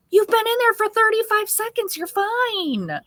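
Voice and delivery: high-pitched mock exasperation; exasperated mom. Mom answering her impatient son, exasperated high voice.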